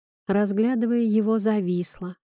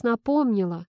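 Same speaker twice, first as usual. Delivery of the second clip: plain reading voice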